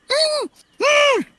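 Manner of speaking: high-pitched muffled "mm, mm"; gagged muffled protest. Acted. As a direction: whimper through a gag, high and urgent. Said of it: "I'm assuming he's gagged".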